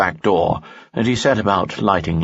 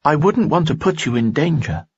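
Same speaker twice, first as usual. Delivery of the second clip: higher young woman's voice, lightly voiced; worried young woman, pitched up; Dina's voice; 10 semitones higher than usual. Dina speaks in story.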